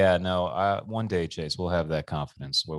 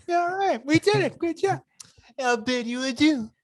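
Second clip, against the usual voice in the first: high, breezy mock-polite Larry voice; sing-song sarcastic politeness, pitched way up; Larry David impression.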